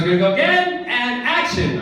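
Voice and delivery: high, loud director's call; director calling action. Retold or imitated. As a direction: call the next take across the set. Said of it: "the director goes".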